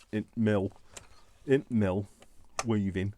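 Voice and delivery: put-on light Yorkshire accent on int mill. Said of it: "Yorkshire accent".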